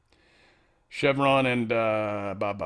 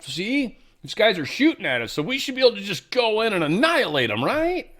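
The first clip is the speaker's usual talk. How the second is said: higher-pitched, exaggerated appeal, ending on a rising question; mock pleading justification; 9 semitones higher than usual. Iran showing envoys pictures of rioters, mock high-pitched self-justifying appeal.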